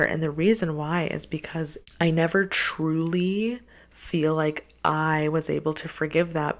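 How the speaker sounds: ordinary talk, nothing marked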